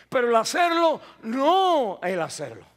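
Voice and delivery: marcar "hacerlo" con fuerza; énfasis corrigiendo. Real, not acted. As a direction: recalcar la negación. ("no el hacerlo" lento y enfático)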